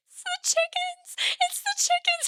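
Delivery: high, strained crying voice while reading; imitating herself bursting into tears; bawling. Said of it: "and I started bawling".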